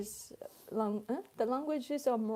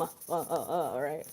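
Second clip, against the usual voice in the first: low, evenly spaced 'uh, uh'; monotone, same rhythm; 7 semitones lower than usual. Shown as a demonstration. Showing her serious political talk, monotone 'uh, uh'. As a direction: identical beats on each 'uh'. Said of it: "in a monotone, same rhythm".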